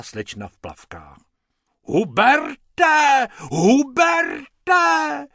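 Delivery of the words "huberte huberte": high, loud calling shriek; shrill woman calling Hubert. A narrator's reading. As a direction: scream his name across the pool. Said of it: "ječela".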